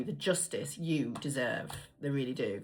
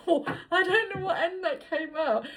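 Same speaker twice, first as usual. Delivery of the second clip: said higher, laughing through every word; laughing repeat; 12 semitones higher than usual. Real, not acted. Reader repeating her slip to viewers, laughing high. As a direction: giggle as you repeat your own blunder. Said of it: "I don't know why I said that like that".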